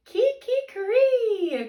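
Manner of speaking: slow high rooster crow. A narrator's reading. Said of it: "crowed the rooster".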